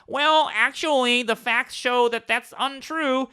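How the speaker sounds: high, slow mock-pedantic voice; mock fact-checker. Countering fake claims with facts, mock fact-checker voice. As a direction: put on a high, drawn-out know-it-all voice. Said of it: "by being like".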